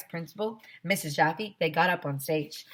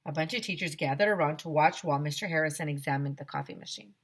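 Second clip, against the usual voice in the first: usual speaking voice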